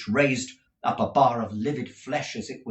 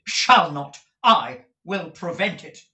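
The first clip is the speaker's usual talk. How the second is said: undaunted defiance; 7 semitones higher, 8 dB louder than usual. Acted. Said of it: "nothing daunted".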